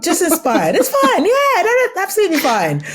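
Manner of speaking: high, laughing, sarcastic "sure, it's fine"; over-the-top sarcastic reassurance through laughter; mock sarcastic agreement. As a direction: play along with a wink, voice way up. Mock agreeing about ripoff.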